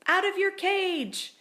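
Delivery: high, loud excited burst; sudden high outburst; loud squeak. A narrator's reading. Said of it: "I squeaked out loud".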